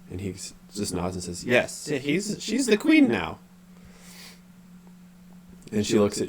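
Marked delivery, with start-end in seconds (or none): 1.4-3.4 very high confirmation, falling at the end; high, dazed statement; weird unconscious voice. Acted. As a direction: affirm it in a high entranced voice. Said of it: "unconscious quality to his voice".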